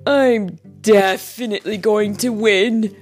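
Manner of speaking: loud, boastful story coyote; cocky and emphatic; bragging. A narrator's reading. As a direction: brag with full confidence. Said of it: "Coyote bragged".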